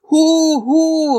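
high, drawn-out hooting; crowd jeering. A narrator's reading. Opponents jeering Diederich, hooted call. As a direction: hoot the speaker down. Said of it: "riefen die Vaterlandsverräter".

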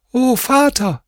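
put-on high child voice calling out; children crying out. A narrator's reading. Children call to their father again, high child voices. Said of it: "sie schrien wieder".

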